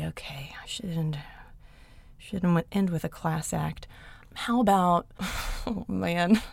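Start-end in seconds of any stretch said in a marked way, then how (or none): 0.0-3.5 a soft whispered okay to herself. Real, not acted. Choosing a favorite while whispering to herself. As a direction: breathe it out unvoiced, thinking aloud.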